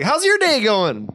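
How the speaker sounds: high, slow, drawn-out small talk; mock a too-chatty cheerful server; overfriendly drive-thru guy. Imitates overfriendly drive-thru employee.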